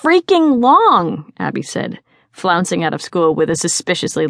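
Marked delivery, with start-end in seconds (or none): 0.0-1.3 high, loud, drawn-out complaint; exasperated whine. A narrator's reading. Teen complaining to her friends as school lets out, exasperated. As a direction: stretch out the exasperation.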